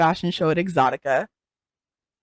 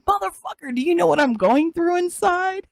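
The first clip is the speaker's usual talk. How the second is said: high-pitched mock outrage; indignant retort; 11 semitones higher than usual. Performer answering people who call her horrible on set, indignant. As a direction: throw it back at the critics in a high, incredulous voice.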